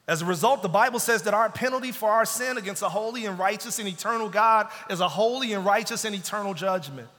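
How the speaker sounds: loud, high, driving preacher climax; rising preacher's cry about judgment; preaching at full force. Acted. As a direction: hammer the judgment home from the pulpit.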